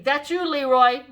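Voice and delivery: loud slow pointing-out; single him out; big brother bossing siblings; bossy call-out. A narrator's reading. Ralph teasing brothers.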